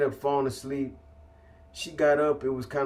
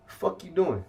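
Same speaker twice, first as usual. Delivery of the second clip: higher-pitched, indignant retold question; retold fired-up challenge; 5 semitones higher than usual. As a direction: challenge her, mildly worked up. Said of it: "getting more fired up by the minute".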